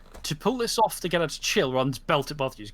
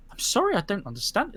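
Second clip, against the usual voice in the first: puzzled apology; 6 semitones higher than usual. Acted. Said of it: "she frowns, like".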